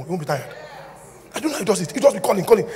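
ordinary talk

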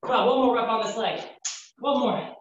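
high, strained encouragement; lifting weights. Real, not acted. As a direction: push through the final rep. Straining on last reps.

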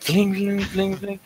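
high, sing-song "bling, bling"; imitated alert bling. Bomb player mimicking an alert to partner, high imitated bling.

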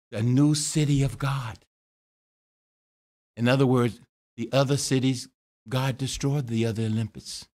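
usual speaking voice

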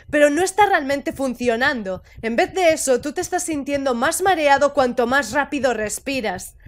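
normal talking voice

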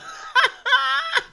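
very high gleeful squeal of laughter. Real, not acted. Fan laughing at rattled City, high squeal. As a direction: shriek with glee. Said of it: "Oh, that's hilarious".

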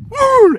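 high, loud lip-flapping horse snort. Retold or imitated. Horse answering dad with a loud high lip-flap snort. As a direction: blow a raspberry like an annoyed horse. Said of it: "The horse went".

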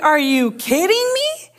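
exasperated king's tirade; mock outraged king. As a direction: play the king fuming at the nerve of it, a bit hammed up. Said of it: "He's like".

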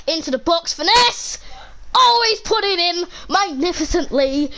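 rising, high burst of celebration; excited goal call. Real, not acted. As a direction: build to a triumphant goal call. Goal celebrated in game commentary.

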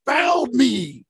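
high, slow insistent complaint; angry protest. Retold or imitated. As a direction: repeat it like a stubborn angry rider. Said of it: "you know, he's angry".

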